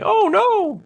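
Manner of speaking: very high, squealing no's of a doomed man; hammed-up terror, echoing the scene; mock panic. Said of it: "then suddenly they're gonna kill me".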